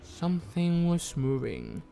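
slow, drawn-out spooky storytelling; dramatic story narration. Acted. Streamer reading horror game text to viewers, dramatic narration. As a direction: read it slowly, stretching the words for suspense. Reads game's narration aloud.

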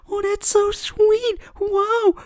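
high, slow, sugary mock of the guest; mocking imitation. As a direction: parody her sweetness in a high put-on voice.

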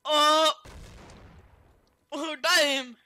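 loud startled exclamation; excited shout. Real, not acted. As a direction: yell out in surprise. Reacting to a big hit.

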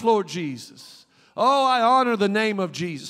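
loud, declaring prayer; fervent praise prayer. Acted. Preacher shows the church how to pray for healing, loud and fervent. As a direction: lift thanks up to Jesus with full voice.

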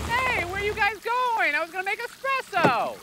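creepy host calling. Acted. Creepy host calling after guests.

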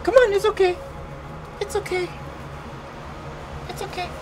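high cooing reassurance, fading; coaxing the dog. Real, not acted. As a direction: coax a scared dog gently.

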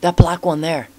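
high, eager character voice; excited pointing out. Acted. Game character showing off a wild pig, excited. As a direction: call attention to it in a high voice.

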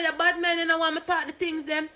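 high-pitched retold scolding of Gio; a sharp, exasperated mock rebuke. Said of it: "I say".

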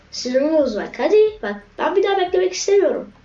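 high, loud, fast frustrated outburst. Real, not acted. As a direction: snap it out, fed up. Angry at waiting in queue.

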